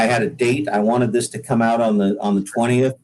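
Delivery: normal talking voice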